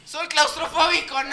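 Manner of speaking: loud high mocking cry; mock drunk protest. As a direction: shout it like a drunk dodging rehab. Burla imitando al borracho. (grito burlón de borracho)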